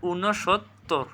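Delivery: "ঊনসত্তর" slowly as ঊনো-সোত্-তোর; correct pronunciation. Shown as a demonstration. Teacher showing correct pronunciation, "ঊনসত্তর" slow and split. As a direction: separate the pieces of "ঊনসত্তর". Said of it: "সঠিক উচ্চারণ কী হবে".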